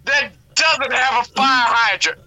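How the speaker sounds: ordinary talk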